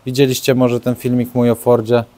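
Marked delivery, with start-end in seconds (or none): none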